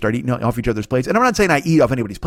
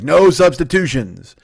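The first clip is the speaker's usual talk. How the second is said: loud, slow, drawn-out decree; mock strict refusal; 3 semitones higher, 5 dB louder, much slower than usual. Kitchen refusing changes to the menu, mock strict. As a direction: lay down the rule in a put-on voice. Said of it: "this new trend is sort of like".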